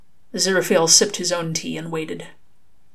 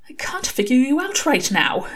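a touch higher, puzzled angel; lightly voiced, baffled angel; 7 semitones higher than usual. Angel puzzling over Crowley, lightly higher voice.

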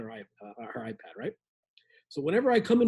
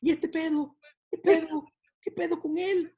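higher, lilting tune on "pedo"; sing-song Mexican intonation; 5 semitones higher than usual. Acted. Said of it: "sing-song".

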